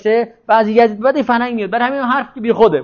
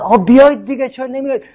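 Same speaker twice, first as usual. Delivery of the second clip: mild mock yell up the stairs; calling people out, half-raised; retold shout; 6 semitones higher than usual. A neighbor urging friends up to chant, mild mock shout. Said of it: "طرف اومد بالا داد زد".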